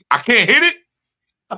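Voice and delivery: mock disappointed student. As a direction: play the letdown big.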